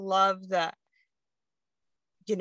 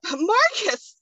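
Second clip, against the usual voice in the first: anguished cry; 12 semitones higher, 7 dB louder than usual. Retold or imitated. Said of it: "and I'm like".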